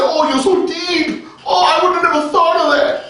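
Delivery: high, gushing fake awe; mock admiration. Imitating an admirer flattering a false prophet, mock admiration. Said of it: "you want somebody to say".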